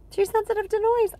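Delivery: high, cooing realization; tender coo. Real, not acted. Mother realizing her baby shares her sensitivity, high coo. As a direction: coo it fondly, recognizing herself in her.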